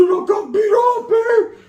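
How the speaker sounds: high, slow whimpering complaint; whiny pained wail. Retold or imitated. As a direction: wail it like a hurt kid. Telling homeboys, pained.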